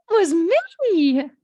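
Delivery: loud, high, stretched-out mock owning-up; eager confession. Child owning up to angry parents, absurdly eager voice. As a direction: sing out the confession far too gladly. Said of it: "Do you think the child wants to go".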